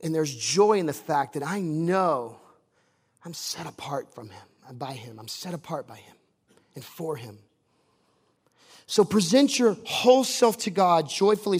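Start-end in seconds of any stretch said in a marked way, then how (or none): 3.2-7.4 very soft, low voice; hushed reverence. Real, not acted. Preacher sharing joy at being set apart, hushed reverence. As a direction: drop right down to a quiet, intimate confession. Hushed personal confession of faith.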